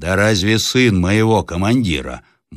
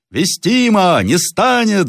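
high-pitched, emphatic mob cry; crowd shouting; 13 semitones higher, 4 dB louder, slower than usual. A narrator's reading. Peasants agreeing no Russian officer would, shouting. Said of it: "закричали крестьяне".

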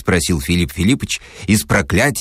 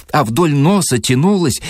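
plain reading voice

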